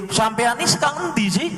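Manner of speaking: quick high-pitched challenge; challenging retort. Retold or imitated. The scientist challenging the claimant, quick and high. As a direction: snap the question back fast.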